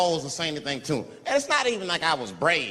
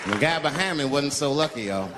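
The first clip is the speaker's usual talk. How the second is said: ordinary delivery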